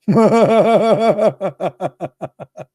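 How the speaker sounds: loud, high staccato cackle; long mocking laugh. Real, not acted. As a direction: burst into rapid high ha-ha-has, slowly petering out. Laughs at the spread news.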